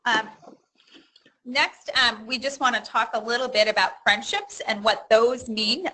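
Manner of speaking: loud, projecting to the back row; outside playground voice. Real, not acted. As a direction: speak up so everyone hears. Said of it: "I'm gonna put that on".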